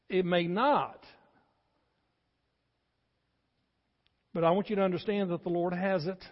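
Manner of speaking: loud, earnest pulpit preaching; preacher building to full force. Acted. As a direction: press it home to the congregation.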